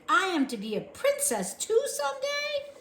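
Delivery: very high hopeful question; hopeful girl. A narrator's reading. Mig hoping aloud to the soldier, very high. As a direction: dream out loud.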